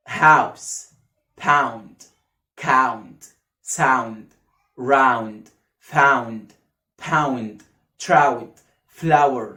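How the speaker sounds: house said with one low smooth ow; correct pronunciation. Shown as a demonstration. Teacher reading the correct ow words. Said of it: "لا، لا، لا".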